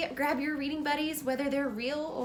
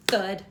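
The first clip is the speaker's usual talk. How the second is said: 1 thud sound; 5 semitones lower, 8 dB louder than usual. A narrator's reading. Sound word of fainting.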